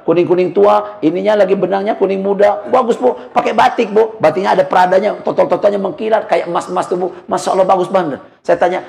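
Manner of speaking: usual speaking voice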